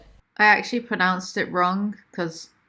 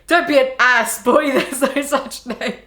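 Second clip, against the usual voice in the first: membentak lalu pecah tertawa; tertawa sambil membaca; 4 semitones higher, 5 dB louder than usual. Real, not acted. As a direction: bacakan sambil menahan tawa. Reader breaks into laughter.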